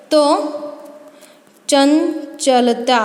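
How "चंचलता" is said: चंचलता drawn out slowly; slow modelling. Shown as a demonstration. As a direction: stretch चंचलता for the class. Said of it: "teacher models the derived word". (चंचलता धीरे-धीरे)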